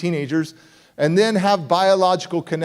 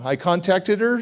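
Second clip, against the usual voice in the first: ordinary talk, nothing marked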